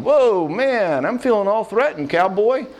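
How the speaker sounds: comic surprise at feeling threatened; mock taken aback. Imagined calm reply to spouse.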